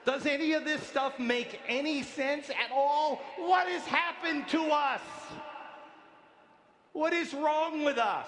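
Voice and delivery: outraged shout. Real, not acted. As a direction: demand an answer from the crowd. Outraged rhetorical questions.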